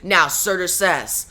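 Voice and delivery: ordinary delivery, nothing marked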